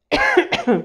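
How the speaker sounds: sudden choking cough; swallowed the wrong way. Real, not acted. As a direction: cough hard, as if something went down wrong. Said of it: "j'ai avalé de travers".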